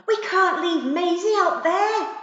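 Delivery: high loud pleading protest; protesting yell. Acted. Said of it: "Zack yelled".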